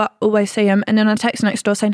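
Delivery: usual speaking voice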